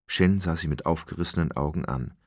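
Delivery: usual speaking voice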